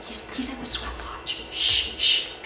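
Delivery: secretive whisper with urgent shushing; hushed whisper. Acted. Sister warns her brothers about the Chenoo, whispering. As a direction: whisper it so the monster won't hear.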